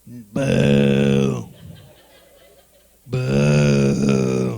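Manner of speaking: long, low, loud boo; deep, sustained mock jeer; crowd booing. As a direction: imitate a disapproving crowd with a deep "boooo". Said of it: "And they were like".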